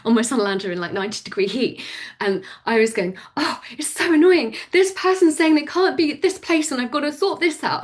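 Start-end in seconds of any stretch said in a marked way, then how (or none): 3.4-7.8 higher-pitched retold whinge; pitched-up mock frustrated rant. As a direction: complain in exasperation, imitating your earlier self. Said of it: "I was getting frustrated".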